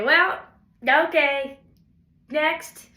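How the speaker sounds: loud, breezy "well, okay, next"; breezy brush-off. Retold or imitated. To herself about mediocre work, breezy and loud. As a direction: shrug it off and move on fast.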